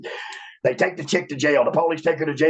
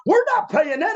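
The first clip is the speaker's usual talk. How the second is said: much higher, indignant refusal; Jim Ross. Retold or imitated. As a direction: refuse it in a mock shrill pitch. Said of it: "And he said".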